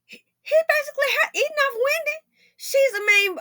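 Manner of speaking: high, whiny fake sobbing; mock crying. Mocking the ex-husband with a tearful high wail. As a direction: ham up a tearful wail, fast.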